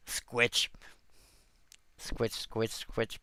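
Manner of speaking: "Squitch" said low and soft, like trying out a name; "Squitch" as one quick syllable ending in tch; made-up pronunciation. Real, not acted. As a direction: say "Squitch" with a short i and a final tch. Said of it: "I wanna pronounce your name that way".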